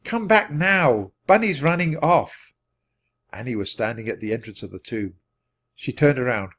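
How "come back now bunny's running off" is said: high, loud, urgent shout; raised voice, calling someone back. A narrator's reading. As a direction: shout a warning across a distance. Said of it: "he shouted".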